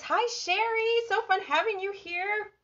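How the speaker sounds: very high, singsong welcome; excited greeting. Real, not acted. Host welcomes viewer joining live, excited. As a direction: greet a friend in a lifted, delighted pitch.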